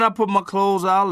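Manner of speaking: ordinary talk, nothing marked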